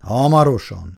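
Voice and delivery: gruff fatherly grumble, a bit loud; lightly voiced grumbling father. A narrator's reading. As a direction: grumble it for all to hear. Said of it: "dörmögte Szabó, olyan hangosan".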